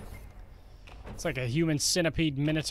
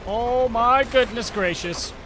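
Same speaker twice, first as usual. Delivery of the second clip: amazed; 6 semitones higher, 7 dB louder, much slower than usual. Real, not acted. Overwhelmed by enemy attack.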